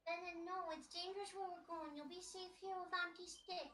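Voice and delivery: gentle reassuring. Acted. Player character comforts pet cat.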